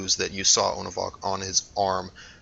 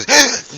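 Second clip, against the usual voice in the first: sharp gasp; 8 dB louder than usual. Acted. GM voices startled creature.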